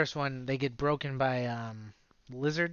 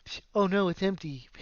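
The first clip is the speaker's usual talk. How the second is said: lightly voiced hero finding his web empty; a hint of hero dismay, pitch lifting on oh no; mock Spider-Man; 3 semitones higher than usual. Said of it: "He was like".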